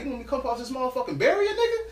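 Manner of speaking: mock-furious challenge, pitch jumping on the last word; angry street threat. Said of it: "I immediately switched".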